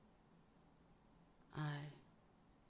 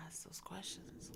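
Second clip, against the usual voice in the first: mischievous whisper, much softer; sly whisper; 15 dB quieter than usual. Pretend. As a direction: whisper it like a naughty secret. Defying the sales lady's warning.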